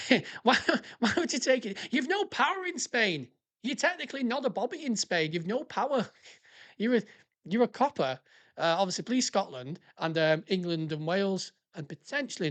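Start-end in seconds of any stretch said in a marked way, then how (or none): 0.1-8.2 high-pitched, incredulous questioning; baffled, exasperated amusement; amused disbelief. Real, not acted. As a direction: protest the absurdity with a laugh breaking in. Incredulous at officer's mistake, laughing.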